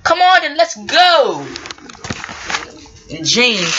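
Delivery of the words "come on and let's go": high, loud send-off; rallying call. Acted. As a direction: call everyone to set off. James calls out to leave.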